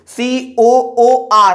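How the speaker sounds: each letter of सीओओओएच stretched very slowly; drawn-out dictation. Shown as a demonstration. Teacher dictating the formula to students, very slowly drawn out. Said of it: "teacher drawing out formula letters".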